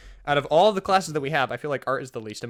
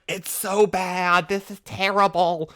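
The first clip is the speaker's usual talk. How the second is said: drawn-out, louder strained wail; mock choking classmates; 4 semitones higher, 3 dB louder, much slower than usual. Classmates fleeing the stink bomb in the bathroom, mock choking. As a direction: imitate sputtering kids, stretching the words. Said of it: "coughing and sputtering".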